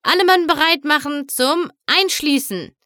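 rally the crew with a shout; commanding. A narrator's reading. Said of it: "befahl Kapitän Silano".

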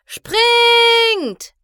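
high, loud yell to jump; shouting. A narrator's reading. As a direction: shout it out in alarm. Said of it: "schrie Applejack".

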